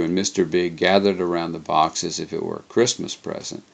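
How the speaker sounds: plain reading voice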